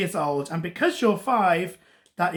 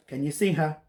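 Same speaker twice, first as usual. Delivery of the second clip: plain reading voice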